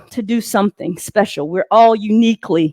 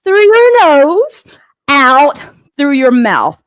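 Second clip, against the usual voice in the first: drawn-out, slow breathing instruction; slow breathing cue; slower than usual. Shown as a demonstration. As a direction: stretch each word out slowly. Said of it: "Remember to breathe in".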